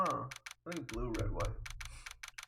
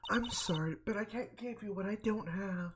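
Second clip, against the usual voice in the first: higher-pitched woman's role voice; defiant Miss Fay; 7 semitones higher than usual. Acted. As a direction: refuse politely in a raised pitch.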